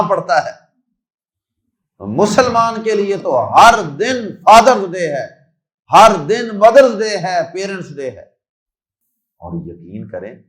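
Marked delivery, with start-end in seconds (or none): 2.0-8.3 loud, high proclaiming; preacher lifts his voice to the crowd, loud and high; rising preacher's cry. Real, not acted. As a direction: ring it out over the crowd.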